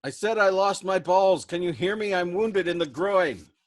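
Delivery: wounded man screaming his injury over the engine noise; desperate shout. Acted. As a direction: yell it so he finally hears. Shouting to a crewmate who can't hear.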